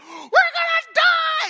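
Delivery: high, loud, slow terrified cry; panicked scream. Retold or imitated. Disciples waking Jesus in the storm, panicked scream. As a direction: scream it as the boat goes down.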